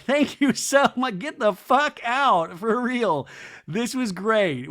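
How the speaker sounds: high, slow, loud fake-polite send-off; exaggerated thanks while shooing guests out; mock gracious hosts. Winery owners' forced politeness, acted.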